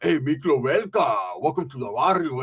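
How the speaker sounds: slow, drawled gangster voice; mock movie gangster greeting; Miklo impression. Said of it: "what's his name from fucking Blood In".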